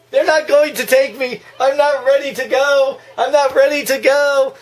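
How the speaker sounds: high, pleading, laughing; mock old lady panic. Voicing Missus Deagle, high mock pleading. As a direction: beg like she is doomed.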